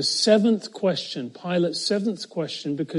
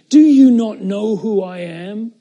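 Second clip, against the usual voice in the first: Pilate's authority; 5 semitones higher, 9 dB louder than usual. Acted. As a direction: pull rank on the silent prisoner. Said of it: "beaten state and saying".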